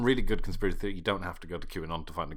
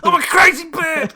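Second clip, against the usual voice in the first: high, loud squawking parrot voice; crazy bird; 18 dB louder than usual. Pretend. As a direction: screech it like a wild parrot. Pretending to be a parrot.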